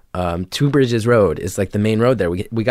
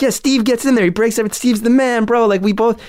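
eager agreement; 11 semitones higher than usual. Retold or imitated.

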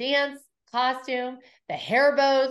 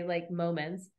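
normal talking voice

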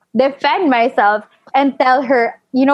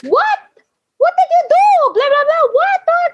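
very high, frantic mock shriek; hammed-up high-pitched motherly outburst; mother shouting. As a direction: imitate a mom losing it, voice shooting up. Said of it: "my mom started shouting".

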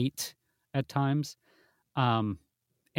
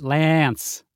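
Lance said loud and nasal; nasal pronunciation; 7 dB louder than usual. Shown as a demonstration. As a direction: push Lance through the nose. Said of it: "as nasal as I do".

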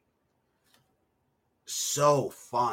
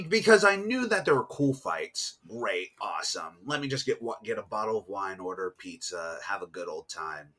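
ordinary delivery

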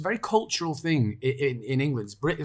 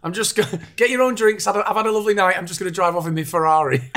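louder, higher, laughing posh show-off; mock show-off; 7 semitones higher, 6 dB louder than usual. Mocking Will to the group, a laughing show-off voice.

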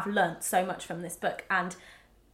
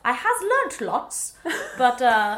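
slow, goofy broken-grammar voice; lolcat meme voice; 3 semitones higher, 6 dB louder, slower than usual. Pretend. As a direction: say it like an old internet meme. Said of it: "That's a really old school meme reference".

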